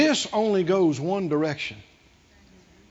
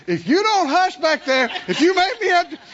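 loud, higher warning from the driver's seat; parent's threat; 8 semitones higher, 5 dB louder than usual. Retold or imitated. Parent threatening whining kids in the car, loud and sharp. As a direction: snap back like a fed-up parent. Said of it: "The response is".

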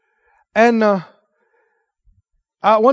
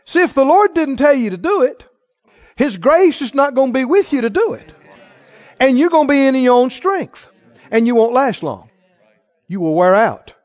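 raised, higher preaching voice building to a peak; preaching at full force; 5 semitones higher, 4 dB louder than usual. Acted. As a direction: drive the warning home to the congregation.

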